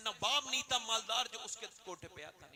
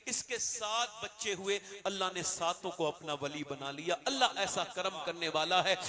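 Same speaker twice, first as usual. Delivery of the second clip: ordinary talk, nothing marked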